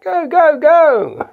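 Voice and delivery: mock panic. Toy figure urging escape, squealing in mock panic. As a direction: shriek it while running away.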